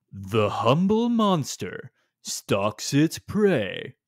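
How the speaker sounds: slow, mock-grand trailer narrator; drawn-out, overdone storybook narration; narrator impression. Co-host mimics the game's terrible narrator, mock-dramatic. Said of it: "here's my impression".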